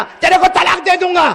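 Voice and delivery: loud mock husband's threat; shouted talaq threat; threatening shout. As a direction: bellow the threat like a bossy husband. Husband's threat retold in sermon.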